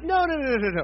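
high-pitched imitation of a crying kid; mock crying. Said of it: "and he's crying".

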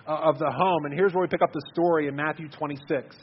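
ordinary delivery

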